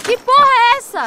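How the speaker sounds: high, loud startled cry; shocked outburst. Acted. Clara startled by the old man's outburst, loud shocked cry. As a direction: blurt it out in shock. Drunk ex-cop suddenly starts screaming.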